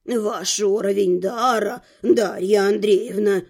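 put-on low, measured old man's voice; old rector. A narrator's reading. Rector explains how mages are recruited, measured old man. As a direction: explain it slowly in a deep register. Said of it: "пояснил ректор".

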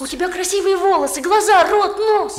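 shouting after the bus. Acted. The boy runs alongside the bus shouting to the girl. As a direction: shout it while chasing the bus.

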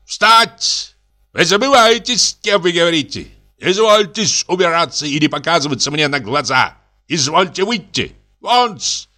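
high, imperious shouted orders; angry shout. A narrator's reading. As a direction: order him out at a furious high pitch. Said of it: "крикнул так громко, что даже дрогнули стёкла".

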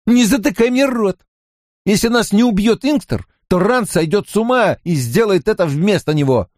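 high-pitched indignant outburst; agitated retort. A narrator's reading. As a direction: refuse to be silenced, frantic. Mat snaps back in panic. (возмущённо, на высоких нотах)

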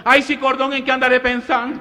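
high, fluttery, flamboyant voice; camp mailman. Retold or imitated. Mailman brushing off his mistake to the family, high and camp.

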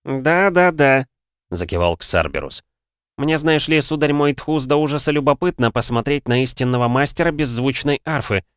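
put-on a spry, proud old alchemist. A narrator's reading. Voiced old alchemist Xarberus.

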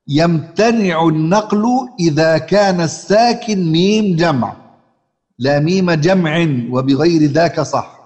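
measured verse recitation, lightly sung; recited verse. Shown as a demonstration. Chants a line of verse. (إنشاد متن تجويدي بتأنٍّ)

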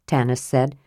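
ordinary delivery, nothing marked

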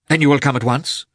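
high, loud urgent question; anxious husband urging the doctor. A narrator's reading. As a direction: push him to come now.

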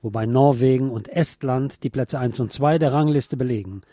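even, measured newsreader delivery; news read. Acted. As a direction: read it like a radio news bulletin. News bulletin read by anchor. (Nachrichtensprecher-Ton)

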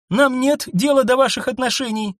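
tactless blurt. A narrator's reading. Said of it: "совершенно бестактно брякнула Анна".

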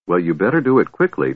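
ordinary delivery

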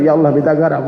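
prayer turned up a little to be overheard; mock audible prayer. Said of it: "Kalau kurang kerasnya suara dikit".

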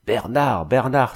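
restrained alarm in a called name; terrified cry. A narrator's reading. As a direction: call out with a touch of dread. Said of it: "s'écriait-elle d'une voix pleine d'épouvante". (appel un peu épouvanté)

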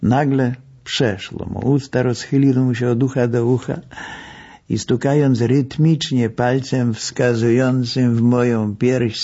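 plain reading voice

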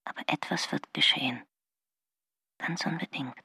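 a hushed breath of a whisper, admitting it; whispered confession. Acted. Margarita admitting to herself that she lied, whispered. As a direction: whisper it as if afraid to be overheard.

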